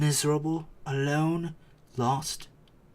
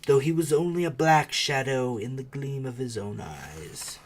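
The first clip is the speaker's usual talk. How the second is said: plain reading voice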